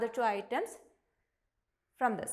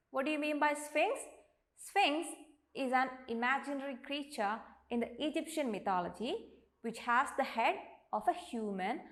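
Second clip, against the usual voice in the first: usual speaking voice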